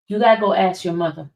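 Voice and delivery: ordinary delivery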